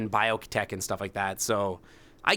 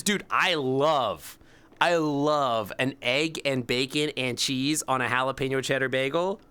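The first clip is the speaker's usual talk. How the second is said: ordinary talk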